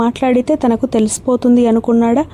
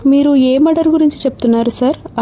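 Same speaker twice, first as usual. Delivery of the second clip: plain reading voice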